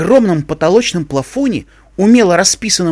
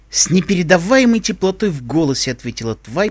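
ordinary delivery, nothing marked

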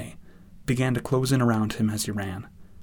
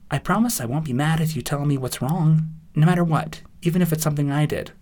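reassuring Twilight; 5 semitones higher, 4 dB louder than usual. Acted.

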